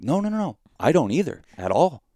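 gleeful no no no; eager reassurance. Retold or imitated. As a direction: wave off the worry, excited. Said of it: "And I'm like".

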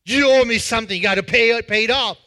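an angry creditor confronts his debtor; angry demand. Acted. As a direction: yell at him to pay up. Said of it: "and this guy says".

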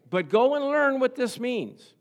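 high, loud and slow proclamation; emphatic scripture reading. Acted. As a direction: proclaim it slowly to the congregation. Reads Jesus' words aloud emphatically.

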